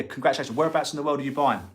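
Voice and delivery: ordinary delivery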